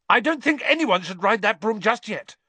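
loud, high, shrill objection; shrilly. A narrator's reading. Girl warning friends off the suspicious broom, shrilly. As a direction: object shrilly, pitched right up. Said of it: "said Hermione shrilly".

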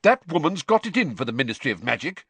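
put-on affronted young official; furious. A narrator's reading. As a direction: complain angrily about an enemy. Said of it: "said Percy furiously".

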